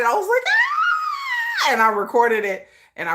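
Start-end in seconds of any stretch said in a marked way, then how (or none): 0.5-1.7 very high fangirl squeal; piercing high squeal of delight; excited squeal. Retold or imitated. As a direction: let out a thrilled shriek. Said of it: "I was so excited".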